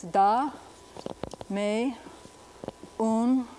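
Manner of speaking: da, me, un as separate syllables with long pauses; syllable-by-syllable Spanish. Shown as a demonstration. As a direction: sound out da, me, un in pure Spanish vowels. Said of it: "First syllable is accented".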